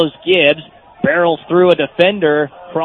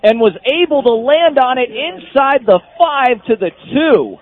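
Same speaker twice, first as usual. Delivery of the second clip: excited play call; 7 semitones higher than usual. Real, not acted. Call after recovered fumble.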